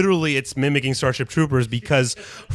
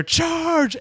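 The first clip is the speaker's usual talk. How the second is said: charge; 13 semitones higher than usual. Retold or imitated. General calls the charge.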